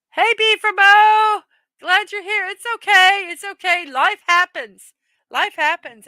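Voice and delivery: big cheerful hello to a viewer who just joined; excited greeting. Real, not acted. Greeting a viewer joining the stream.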